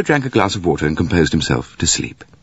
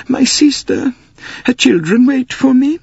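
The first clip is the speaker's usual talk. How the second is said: weeping; 3 dB louder than usual. Acted. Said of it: "and wept".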